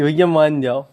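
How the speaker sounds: mother voicing her baby begging her to forgive him. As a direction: put words in the baby's mouth, coaxing. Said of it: "हाथ पकड़ता है कि".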